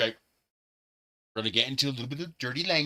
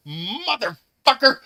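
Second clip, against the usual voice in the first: high, loud, drawn-out mock curse; hammed-up furious cussing; retold angry curse; 11 semitones higher, 7 dB louder, slower than usual. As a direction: imitate him swearing from upstairs. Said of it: "I heard him cursing".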